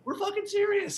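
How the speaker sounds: high, loud urgent warning; urgent mock shout. Staff warns guests to evacuate before the mudslide, in an urgent high shout. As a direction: shout that they mean it. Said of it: "People are, like".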